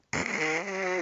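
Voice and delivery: rasping burst of mouth-made static; imitated bad reception; imitated phone noise. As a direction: mimic the annoying radio crackle. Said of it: "Like that".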